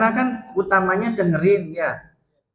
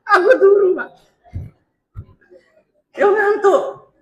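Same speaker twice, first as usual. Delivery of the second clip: whiny excuse; 6 dB louder than usual. Pretend. Mock whiny excuse to men.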